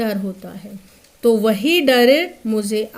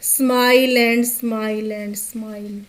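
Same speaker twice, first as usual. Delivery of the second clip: slow repeated smiling line; 2 semitones lower, much slower than usual. Shown as a demonstration. Teacher reading the poem's line to her class, very slow. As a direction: read it very slowly, word by word. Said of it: "क्या किया?".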